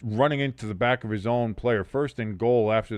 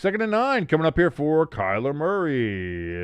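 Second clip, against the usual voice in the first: ordinary delivery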